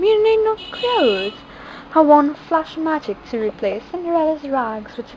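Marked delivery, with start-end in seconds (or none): 0.0-1.3 high, lightly voiced witch. A narrator's reading. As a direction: tell her kindly, higher up. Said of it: "the witch exclaimed".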